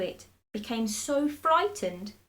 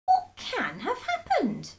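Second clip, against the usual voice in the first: put-on high, fretful old woman; Mrs Twit voice; 13 semitones higher than usual. A narrator's reading. Old wife frets over her stick, high and worried. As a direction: pitch her up, anxious and puzzled.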